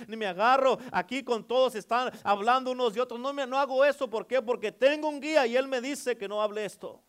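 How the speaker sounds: loud, high, driving preacher voice; fiery preaching. Acted. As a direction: preach it hard to the congregation. Preaching at full force.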